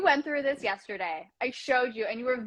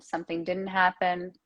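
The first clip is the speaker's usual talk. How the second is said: normal talking voice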